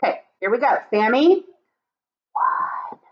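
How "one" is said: unvoiced whispered count; whispered number. Puppet whispers the first number to class. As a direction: breathe the number, no voice. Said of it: "Now he's gonna whisper".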